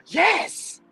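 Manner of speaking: gasp, then a high, loud yes; sudden delighted relief; excited relief. Real, not acted. Relief when one earbud works.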